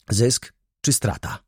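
usual speaking voice

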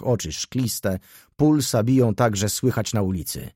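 ordinary delivery, nothing marked

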